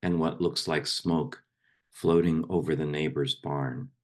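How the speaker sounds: poem read aloud in measured, fuller voice; firmly projected poetry reading; poem recitation. Acted. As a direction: recite it line by line, letting phrases fall at the ends. Recites a poem to a meditation group.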